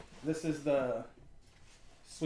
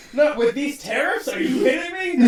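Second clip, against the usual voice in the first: loud, high outraged yell; outraged shout; 11 semitones higher, 11 dB louder than usual. Acted. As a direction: yell it like a furious rant. Outraged rant about tariff prices.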